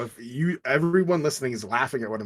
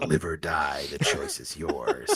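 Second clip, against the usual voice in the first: lower, slow menacing villain voice; movie villain; 9 semitones lower, slower than usual. Pretend. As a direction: deliver the ultimatum like a horror villain. Villain ultimatum joke.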